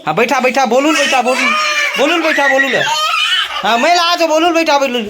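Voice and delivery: high, loud laughing orders; laughing command. Real, not acted. Telling Bolu to sit down, laughing loud high shouts. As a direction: shout at the animal through laughter.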